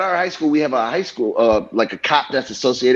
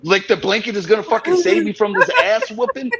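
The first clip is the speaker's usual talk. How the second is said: high laughing recall; 7 semitones higher than usual. Retold or imitated. Acts out hiding under blanket.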